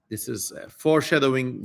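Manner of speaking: ordinary talk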